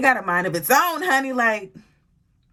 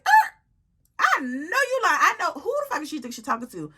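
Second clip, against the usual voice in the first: outraged disbelief; 8 semitones higher than usual. Retold or imitated. Vlogger reacting to the roommate's tone, high incredulous outrage. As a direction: stop and let the outrage rise high. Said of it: "dramatic pause".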